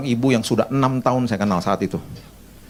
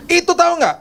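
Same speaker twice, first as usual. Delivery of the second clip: much higher, sharp reproach; scolding tone; 4 dB louder than usual. Retold or imitated. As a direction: scold like a strict nanny. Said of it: "dengan nadanya gini nih".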